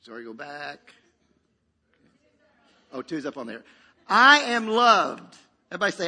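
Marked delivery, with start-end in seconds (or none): 4.1-5.4 loud, higher, slow and drawn out; ringing, stretched affirmation; emphatic declaration. Acted. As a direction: proclaim it so the congregation repeats it. Preacher declares line for congregation.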